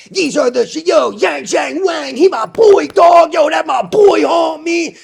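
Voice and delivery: high, loud shouting hype-man voice; over-the-top imitation of a pumper; mock hype-man. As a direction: ham up a pumped fan yelling about his boy. Mocking hype pumpers of stock.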